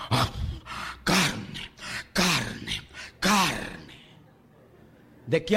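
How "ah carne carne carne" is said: mock craving. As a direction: lower and lower, longing carne each time. Israel craving meat.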